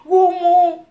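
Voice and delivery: high, loud panicked wail; retold scream. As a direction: wail it like you fear the crash. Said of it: "j'ai crié".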